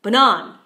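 "banan" with a loud, short, punched "nan"; weak "buh", hard stress on the end of "banan"; stress on second syllable. Shown as a demonstration. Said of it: "put a stress on the second syllable".

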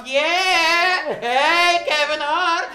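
loud, high, drawn-out cheer; re-enacted fan shout breaking into laughter; excited cheer. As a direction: whoop it out like a thrilled fan. Re-enacts cheering at his name.

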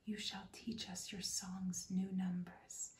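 usual speaking voice

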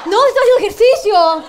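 loud, laughing sarcastic retort; sarcastic comeback. Retold or imitated. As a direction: snap back with loud mock pride.

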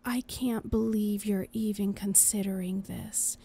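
ordinary delivery

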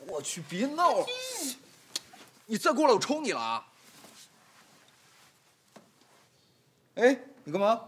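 exasperated threat. Acted. Pushing her away, threatening.

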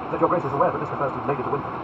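firm, reproachful challenge; abrupt, reproachful. Acted. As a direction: press him with mild indignation. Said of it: "more and more abrupt and reproachful".

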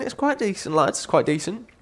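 high, soft squeaky voice; playful high-pitched repetition; squeaky repeat. Real, not acted. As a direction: pitch it way up, keeping it light. Playful repeat of own verdict.

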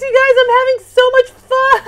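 sharing delight; real giddy joy. Real, not acted. Giddy unboxing.

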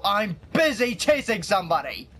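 ordinary delivery

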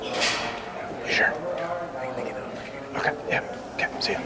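hushed sales pitch; whispering. Real, not acted. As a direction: whisper it so no one hears. Sneaky whispered snack offer.

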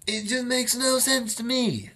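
bewildered. Real, not acted. Bewildered by Meisner technique.

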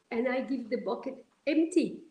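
normal talking voice

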